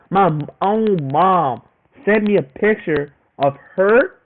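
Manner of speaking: usual speaking voice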